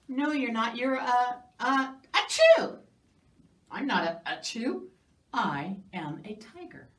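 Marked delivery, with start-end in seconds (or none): 1.6-2.8 mock sneeze, loud. Narrator faking a sneeze instead of saying mouse. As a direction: fake a big sneeze on chew.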